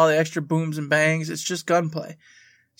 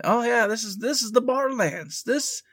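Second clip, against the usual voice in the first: excited; 6 semitones higher than usual. Retold or imitated. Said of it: "And I went".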